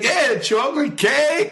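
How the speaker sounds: high, slow, gloating taunt; mocking tease. Stoned coworker taunting the date over the phone, high slow gloat. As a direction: drag it out to rub it in.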